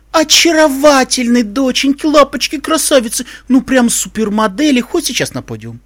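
gushing grande dame. A narrator's reading. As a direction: lay the flattery on thick. Rarity's gushing compliments.

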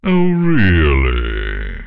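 loud, drawn-out sceptical taunt; haughty dragon disbelief, slow and loud; mocking doubt. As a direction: stretch it out with haughty mockery. Dragon taunts the protesting pony.

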